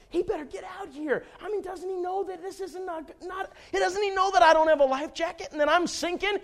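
high-pitched fretting, not loud; mock panic. Retelling his thoughts as he sinks, mock panic. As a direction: fret in a rising panic, played for laughs. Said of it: "I started to panic".

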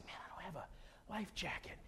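hushed whisper to yourself; whispered dread. Retold or imitated. Man adrift in the lake without a life jacket, whispered dread. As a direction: whisper it as the trouble dawns.

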